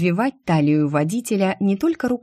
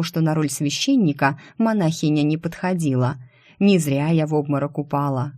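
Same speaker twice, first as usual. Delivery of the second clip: plain reading voice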